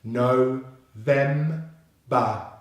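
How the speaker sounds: "no, them, ba" as three separate beats, no-vem-ba; syllable by syllable. Shown as a demonstration. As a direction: sound out "no, them, ba" with gaps, V in the middle. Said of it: "So together we have".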